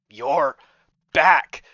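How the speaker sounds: sudden loud, bright greeting on back; cheerful shout. Acted. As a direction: burst out the second word.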